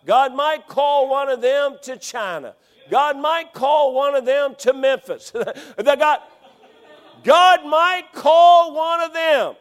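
loud, high, slowing preacher roar; fiery sermon climax; full-force preaching shout. Real, not acted. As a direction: shout the calling to the rafters.

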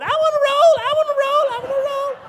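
put-on very high, loud kid chant; excited little girls. Daughters begging for a thrown roll, very high excited chant. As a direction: squeal it like children begging for a roll. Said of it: "my girls were going".

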